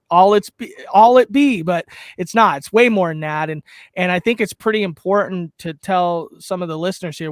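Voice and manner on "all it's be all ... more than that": insistent, rising emphasis; emphatic and louder. Real, not acted. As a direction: push the point hard, louder. Host stresses his point strongly.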